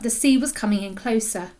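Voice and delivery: ordinary delivery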